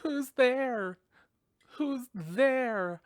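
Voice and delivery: high, loud frightened call into the dark; scared girl calling out. Retold or imitated. Girl alone at home calls out to the noise, frightened. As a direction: call out nervously to an unseen intruder. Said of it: "and then she goes".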